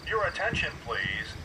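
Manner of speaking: ordinary delivery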